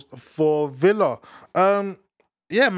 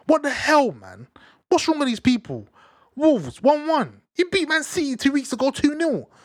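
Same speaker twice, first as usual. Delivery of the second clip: pitched way up, incredulous ranting at Wolves; exasperated rant; 11 semitones higher than usual. Real, not acted. Fan venting at Wolves for drawing, high exasperated rant. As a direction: vent in disbelief at a team that throws away momentum.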